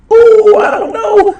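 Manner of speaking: high, slow laughing squeal of doubt; laughing ooh. Real, not acted. Torn over a Rumble winner pick.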